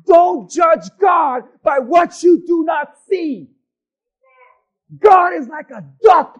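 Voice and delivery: shouted sermon climax; fiery preaching. Real, not acted. Preacher shouting to his church. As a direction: preach it hard at the congregation.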